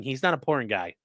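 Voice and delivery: usual speaking voice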